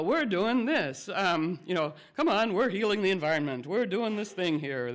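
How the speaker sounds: loud, high, cheerful coaxing push; upbeat coaxing. Pretend. Coaxing politicians like children, loud and upbeat. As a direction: cheer them along like an eager coach. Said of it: "so we go".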